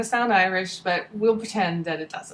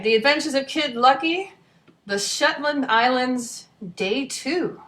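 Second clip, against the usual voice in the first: story title announcement; 4 semitones higher than usual. A narrator's reading. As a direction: announce the title like a show opener.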